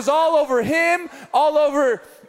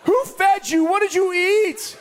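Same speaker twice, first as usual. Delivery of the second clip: mock crying; 8 semitones higher than usual. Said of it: "I just started crying".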